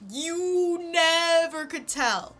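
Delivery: stretched out high and slow; slow teasing drawl. Pretend. Teasing viewer about her fake American accent, slow and high. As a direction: savor the reveal, very slowly.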